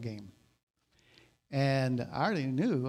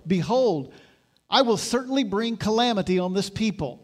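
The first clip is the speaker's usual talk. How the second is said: higher, louder impassioned preaching; preaching at full force; 4 semitones higher, 4 dB louder than usual. Acted. Pastor urging the congregation to accept correction, impassioned. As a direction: urge them to accept help.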